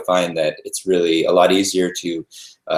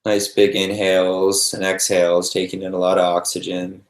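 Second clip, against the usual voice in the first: calm meditation guide with long, restful pauses; guided meditation. Real, not acted. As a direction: ease them gently into noticing the breath, unhurried.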